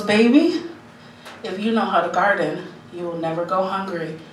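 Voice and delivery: low, soft grandmotherly advice; wise grandmother. Retold or imitated. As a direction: hand down wisdom quietly, as a grandmother. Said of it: "my grandmother was, like, wise".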